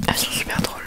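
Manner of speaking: ordinary talk, nothing marked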